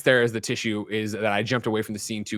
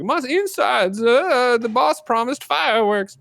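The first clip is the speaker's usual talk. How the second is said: high, put-on prisoner voice, getting louder; big old fat prisoner; 14 semitones higher, 5 dB louder, slower than usual. Prisoner in the holding cell with Joker, hammed-up high voice. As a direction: pitch it way up as a hammed-up oddball inmate. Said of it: "big old fat guy gets in".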